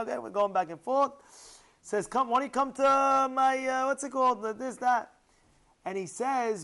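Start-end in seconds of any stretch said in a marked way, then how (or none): 2.1-5.1 hearty inviting. Acted. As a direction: call him over eagerly. Rich man inviting him over.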